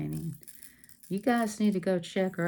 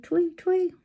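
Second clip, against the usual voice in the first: chirpy tweets, much higher; bird tweet; 11 semitones higher, 4 dB louder than usual. Retold or imitated. Crafter imitating a bird for viewers, high chirp.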